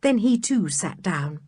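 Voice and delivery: usual speaking voice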